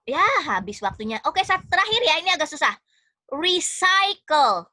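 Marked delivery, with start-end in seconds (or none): 3.3-4.7 Recycle stretched out very slowly, ree-sai-kel; slow dictation. Shown as a demonstration. Teacher gives the class one last tricky word, slow dictation. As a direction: dictate Recycle clearly with long syllables.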